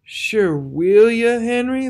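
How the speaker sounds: high, slow pleading voice; pleading wounded soldier; beseeching. A narrator's reading. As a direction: beg him like a frightened child. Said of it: "the tall soldier beseeched".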